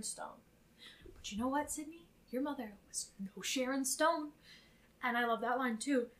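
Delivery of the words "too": ordinary talk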